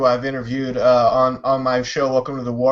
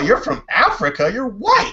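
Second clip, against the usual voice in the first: mock disbelief; 8 semitones higher than usual. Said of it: "I'm all".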